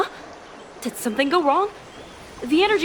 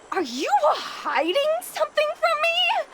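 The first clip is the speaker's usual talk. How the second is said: pin him down; suspicious demand; 11 semitones higher than usual. Acted. Wife pressing her husband, suspicious.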